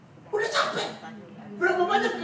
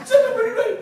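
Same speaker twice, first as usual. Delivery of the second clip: insistent altar call; 9 semitones higher, 11 dB louder, faster than usual. Retold or imitated. Pastor calling, louder each time.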